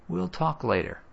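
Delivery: ordinary delivery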